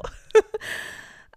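loud, choked laugh; a laugh that breaks with emotion; half laugh, half tears; emotional laugh. Real, not acted. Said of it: "without me getting too emotional".

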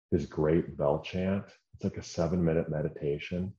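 ordinary talk

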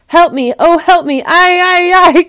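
high, loud wail for help; crying out. A narrator's reading. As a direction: cry out like a trapped wolf in pain. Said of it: "cried the wolf".

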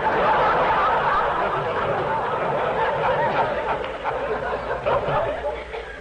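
helpless breathy laughter cracking up mid-line; host laughing too hard to finish; long laughing fit. Real, not acted. Host cracking up at the comedian's joke, helpless laughter.

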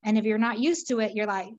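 normal talking voice

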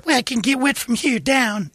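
very high whiny falsetto; hammed-up squeaky girl; mock girly whine. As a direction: whine it again and again. Mimicking fussy girl.

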